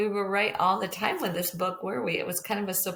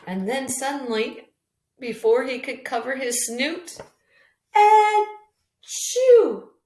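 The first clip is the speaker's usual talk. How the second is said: louder and higher until the big burst; building to sneeze; 6 semitones higher, 10 dB louder, slower than usual. Acted. Teacher building suspense to the flea's sneeze, rising to a loud burst. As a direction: build up then explode the sneeze.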